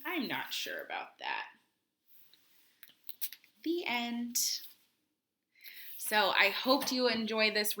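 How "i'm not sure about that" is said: Kelp doubtful. Acted. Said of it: "And Kelp goes".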